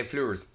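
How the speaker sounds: "fleurs" as one syllable, "floors" with an oo; local pronunciation. Shown as a demonstration. Joking about the Irish word for flowers, squashed "fleurs". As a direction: squash flowers into a single "flurs". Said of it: "we say fleurs instead of flowers".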